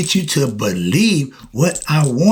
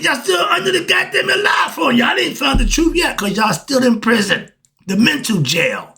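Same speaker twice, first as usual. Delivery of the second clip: furious preaching shout; 10 semitones higher than usual. Real, not acted. Preacher yells at believers that they are not free. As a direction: rage at them that they believe a lie.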